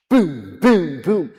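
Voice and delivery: loud punchy booms, each one a hit; mock arrow booms. Rabbi acting out arrows striking the king, loud booms.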